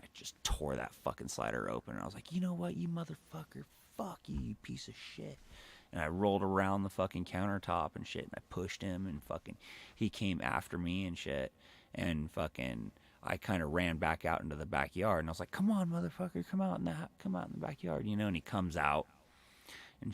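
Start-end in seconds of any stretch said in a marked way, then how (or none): 2.3-5.4 high, cursing mock yell at the stepdad; retold yelling at stepdad. Teen bursting through the slider at his stepdad, high angry yell. 15.5-18.1 high taunting call to fight; calling him out. Retold or imitated. Teen daring his stepdad into the backyard, high taunting call.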